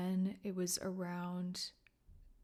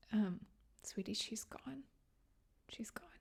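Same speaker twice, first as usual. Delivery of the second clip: soft, breathy near-whisper, lifting higher; tender nurse comfort; 3 semitones higher, 3 dB quieter than usual. Retold or imitated. Nurse softly telling a daughter her mom has died, tender whisper. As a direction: gently break the news like a kind nurse. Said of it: "and she was like".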